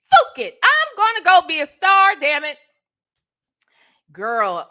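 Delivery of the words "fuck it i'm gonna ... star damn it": defiant declaration. Retold or imitated. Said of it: "And I said".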